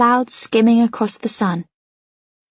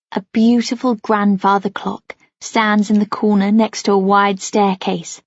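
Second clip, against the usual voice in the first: plain reading voice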